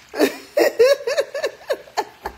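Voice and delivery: helpless high-pitched laughing fit; high cackling laughter. Real, not acted. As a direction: burst out in a long high laugh. Laughs while scolding laughing viewers.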